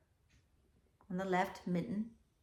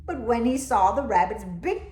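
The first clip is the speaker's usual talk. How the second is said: lively, louder read-aloud; storybook reading; 8 dB louder than usual. A narrator's reading. Teacher reading the class a picture book, lively. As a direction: tell it with bounce.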